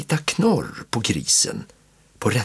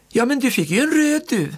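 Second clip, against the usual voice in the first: high, friendly aunt voice; kindly. A narrator's reading. Aunt kindly answering the sulking boy, high and friendly. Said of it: "svarar faster vänligt".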